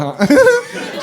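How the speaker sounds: a sudden high laugh; high burst of laughter. Real, not acted. Laughing when the barber is asked to kiss.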